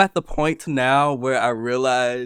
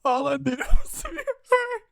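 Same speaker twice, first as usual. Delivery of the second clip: much higher, slow mock wail; wife wailing to her husband, mock hurt.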